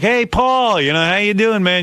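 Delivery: high, loud overeager "hey Paul" greeting; mock-buddy shout-out; loudmouth fan greeting. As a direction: hail him like an overfamiliar fan. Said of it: "Because I was like".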